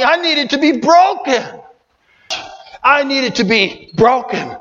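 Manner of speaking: usual speaking voice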